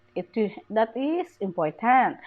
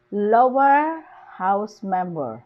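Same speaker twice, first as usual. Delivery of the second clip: slow, "lower" rising and loud, then falling away; stress on LOWER; 4 dB louder, slower than usual. Shown as a demonstration. Teacher shows compound nouns, stress on "lower". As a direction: stretch and hit "lower". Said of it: "the stress is still on the first word".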